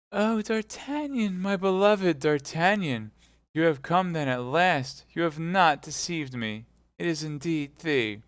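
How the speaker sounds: put-on high, tender young woman's voice; lifted, loving woman's voice; Constance's voice. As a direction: voice her breathless with joy.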